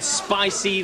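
usual speaking voice

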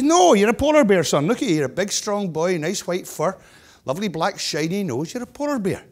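joke-telling protest, mildly hammed-up; insistent daddy bear. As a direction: lightly play an emphatic dad reassuring his cub.

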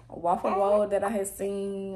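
usual speaking voice

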